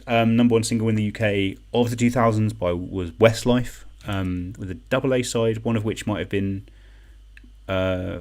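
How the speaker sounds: usual speaking voice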